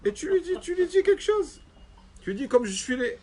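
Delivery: high, indignant rebuke from the wronged man; high-pitched rebuking stranger; indignant ugly man. Acted. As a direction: tell him off in a high voice, go complain to your maker.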